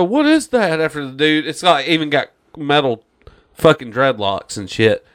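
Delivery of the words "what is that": high mock amazement; hammed-up gawking scientist; mock surprised question. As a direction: squeal it in mock wonder. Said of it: "they go".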